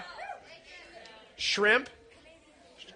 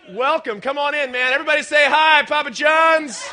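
loud, raised announcer-like welcome; rousing welcome; 6 semitones higher, 8 dB louder than usual. Real, not acted. Host bringing on the pizza delivery man, rousing shout. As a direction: call the crowd to greet the guest.

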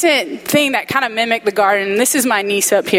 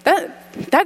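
choked up; 4 semitones higher, slower than usual. Real, not acted. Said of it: "I can't even say it".

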